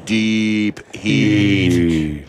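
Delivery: low, very slow, drawn-out words; deep voice demo. Shown as a demonstration. Host feeding caller a line to copy, deep and drawn out. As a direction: stretch each word out down low. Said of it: "Now I can get that deep".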